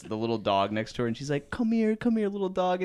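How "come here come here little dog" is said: sweet calling to a dog. Retold or imitated.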